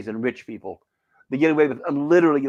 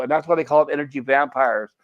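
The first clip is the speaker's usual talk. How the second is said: ordinary talk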